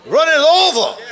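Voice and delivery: "running over" cried out high and loud; preacher's rising cry of joy; exultant cry. Real, not acted. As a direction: lift it up like a joyful shout. Preacher climaxes the psalm quote.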